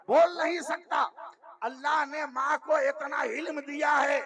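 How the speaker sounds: high, loud, shouted sermon lines; fiery preaching. Acted. A preacher shouting about baby Asghar's crying, fiery. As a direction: drive it home with a roar.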